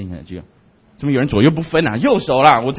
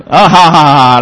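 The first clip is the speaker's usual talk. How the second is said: ordinary delivery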